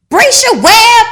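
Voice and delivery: loud excited call across a room; shouting a name. Retold or imitated.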